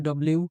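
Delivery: "ডব্লিউ" run together as one word, dob-liu; common variant pronunciation. Shown as a demonstration. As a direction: say "ডব্লিউ" the everyday merged way. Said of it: "এটা আমরা অনেকেই বলি". ("ডব্লিউ" প্রচলিত এক শব্দের উচ্চারণ)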